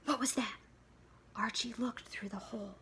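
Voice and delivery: hushed, tense whisper. A narrator's reading. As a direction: whisper as if someone might hear. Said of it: "whispered suspense reading".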